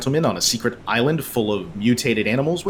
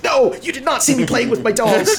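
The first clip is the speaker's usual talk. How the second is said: high-pitched flustered denial; Dark Helmet; 10 semitones higher than usual. Acted. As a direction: act caught out, hammed up and indignant. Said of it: "that scene with him and his dolls".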